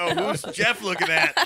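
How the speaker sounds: loud, high teasing taunt; mock a buddy loudly; teasing friend. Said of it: "and then be like".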